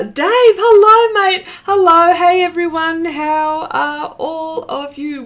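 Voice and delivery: high, loud cheery hello; excited greeting. Real, not acted. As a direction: call out hello to a friend arriving. Greeting a viewer who joined.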